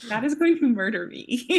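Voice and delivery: real laughter breaking through the words; amused, laughing delivery. Real, not acted. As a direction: say it while cracking up. Cracking up at the innuendo.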